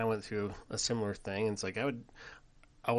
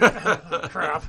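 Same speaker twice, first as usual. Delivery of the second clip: higher and louder, laughing; laughing dismay; 8 semitones higher, 14 dB louder than usual. Retold or imitated. Dad retelling his own reaction, laughing higher and louder. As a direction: laugh out the curse. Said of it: "I'm like".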